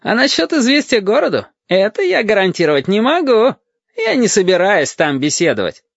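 high-pitched false cheer; unnaturally chipper. A narrator's reading. Lieutenant declines the boy's last request, falsely chipper. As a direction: refuse in an artificially perky high voice. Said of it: "ненатурально бодрым голосом".

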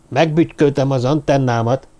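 usual speaking voice

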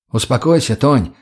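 ordinary delivery, nothing marked

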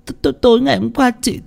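sob-stammered restart. A narrator's reading. Tân restarting her account to Ông Phú, sob-stammering. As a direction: sobs break "tối" into "t-, t-". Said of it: "khóc nấc lên".

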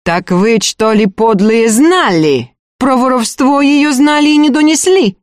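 high, mounting angry interrogation; wrathful accusation. A narrator's reading. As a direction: accuse them, building in indignation. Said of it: "гневно спросила управляющая".

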